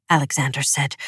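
ordinary delivery, nothing marked

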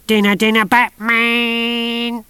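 put-on high, loud old crone singsong; mad old woman. A narrator's reading. Mrs Tachyon calling to the soldiers, high singsong. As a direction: chirp it like a batty old lady.

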